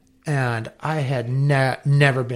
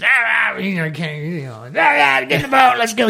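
much higher, louder, fast mock bark; imitating Hobie; 8 semitones higher, 4 dB louder, faster than usual. Mentor Hobie ordering him back on the boat, high hammed-up barking. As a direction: hustle him into the boat like a gruff old skipper. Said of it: "and he's".

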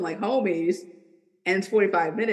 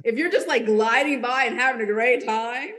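higher, mock-carefree sarcasm; sarcastic; 4 semitones higher than usual. Mocking clients who expect an easy session, sarcastic. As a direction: play the chilled-out client with sarcasm. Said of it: "That's my sarcasm coming out".

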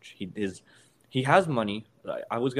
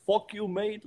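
badly faked Irish accent, pitched much higher; a botched Irish fighter's taunt, voice jumping up high; 12 semitones higher, slower than usual. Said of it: "can't do the Irish accent".

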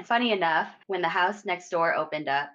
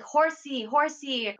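put-on child pleading; 7 semitones higher, slower than usual. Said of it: "I kept telling my grandpa".

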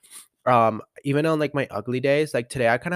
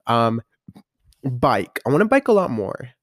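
ordinary talk, nothing marked

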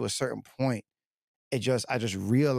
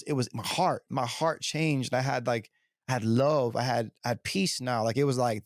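normal talking voice

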